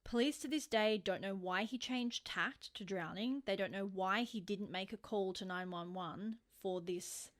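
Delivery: usual speaking voice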